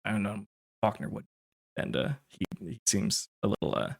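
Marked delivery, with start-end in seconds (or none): 0.1-1.3 soft, fast mumbled muttering; an old father muttering about his lost son; rambling under breath. Acted. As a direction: mutter it to yourself, barely audible. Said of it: "rambling under his breath".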